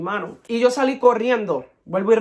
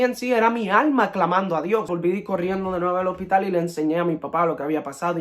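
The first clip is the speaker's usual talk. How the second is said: ordinary delivery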